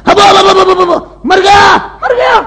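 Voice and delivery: comic howls of pain; pained yelling. Acted. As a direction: yell out like you're being hurt.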